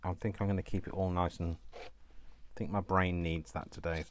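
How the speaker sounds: usual speaking voice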